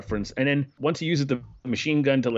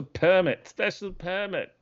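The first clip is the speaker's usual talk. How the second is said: mock New Zealand accent; 8 semitones higher, slower than usual. Quoting New Zealand official's permit line.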